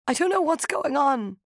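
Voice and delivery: high, tearful plea; crying. A narrator's reading. Narrator crying, begging Rosaleen to explain, tearful and high. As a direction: plead through tears. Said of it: "I was crying now".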